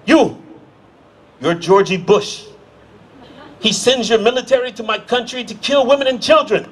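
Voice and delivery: a worked-up stranger, mildly accusing; angry bar patron. Retold or imitated. Angry stranger at the bar accusing the American, mild mock anger. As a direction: jab it at him, half-hearted anger. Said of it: "he comes over to me and he says".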